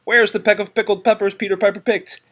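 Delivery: high, fast sing-song patter; fast tongue twister. Shown as a demonstration. Parent rattling off a tongue twister to child, fast. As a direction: rattle it off quickly and brightly.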